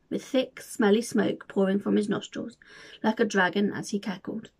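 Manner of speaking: usual speaking voice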